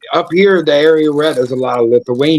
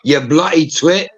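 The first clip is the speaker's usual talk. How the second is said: put-on British accent; 4 semitones higher than usual. Showing off the accent with insults, mock British scolding. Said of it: "a really good British accent".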